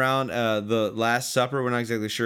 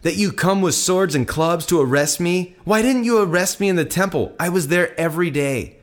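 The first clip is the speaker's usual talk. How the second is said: raised-pitch rhetorical question; indignant challenge; 6 semitones higher, 6 dB louder than usual. Acted. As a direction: challenge the arresting crowd, pitch lifted. Jesus challenges those arresting him.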